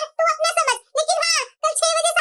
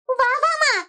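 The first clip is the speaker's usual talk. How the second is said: ordinary delivery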